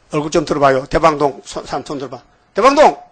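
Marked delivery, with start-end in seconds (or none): 2.5-3.0 high, loud call to the room. Real, not acted. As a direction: summon the Daebang-dong people. 회중을 불러냄. (대방동 사람들을 크게 부름)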